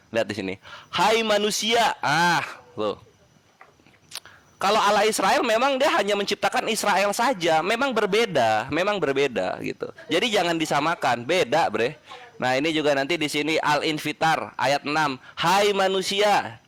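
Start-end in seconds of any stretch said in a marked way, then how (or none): 0.9-1.9 slow, raised proclamation; stretched, higher declamatory quote; verse recitation. Real, not acted. 15.3-16.6 very slow, higher proclamation; stretched declamatory address; verse recitation. Real, not acted. Reciting a quoted verse for emphasis.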